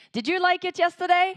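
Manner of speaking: loud, high call out to the crowd; hyping the crowd. Real, not acted. MC firing up five hundred girls, loud and high. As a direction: rev up the room with a big question. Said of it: "You were screaming much better yesterday".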